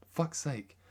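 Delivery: normal talking voice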